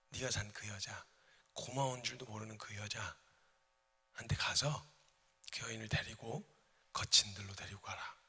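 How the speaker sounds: soft, low whispered command; soft whisper of God to Hosea; God's hushed voice. Acted. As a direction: speak as God, gently and low.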